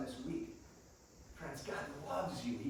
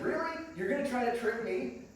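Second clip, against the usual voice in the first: mock disbelief; 7 semitones higher, 4 dB louder than usual. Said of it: "I think he was like".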